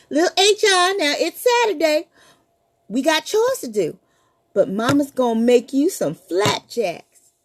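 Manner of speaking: mother calling upstairs; mama calling. Acted. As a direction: call the boy from the kitchen. Mama calls to her son.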